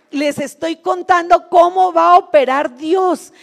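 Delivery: normal talking voice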